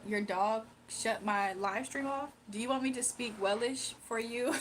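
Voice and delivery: usual speaking voice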